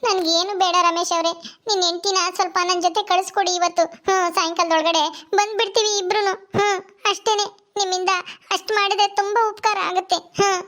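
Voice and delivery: high-pitched voice of a weepy neighbour; tearful pleading woman. Acted. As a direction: beg tearfully for a favour.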